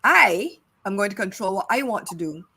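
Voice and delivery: normal talking voice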